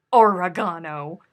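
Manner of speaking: Oregano said or-eh-GAH-no, loud; loud Oregano with the stress on GAH; ore-GAH-no. Shown as a demonstration. Streamer insisting to chat on or-eh-GAH-no. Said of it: "They put the emphasis on different syllables".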